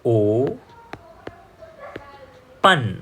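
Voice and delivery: ઓ ... પન split into two slow syllables. Shown as a demonstration. Teacher sounding out ઓ પન slowly for students. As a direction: spell out ઓ then પન with a long pause. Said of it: "ઉચ્ચાર થશે".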